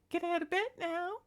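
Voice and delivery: very high bossy cat voice; demanding cat voice. Retold or imitated. Cat demanding his owner get up, in a very high voice. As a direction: order her out of bed like a cat.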